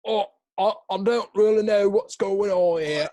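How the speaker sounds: high, loud hammed-up Cockney; Cockney accent. Shown as a demonstration. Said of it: "that's what the Cockney accent basically is".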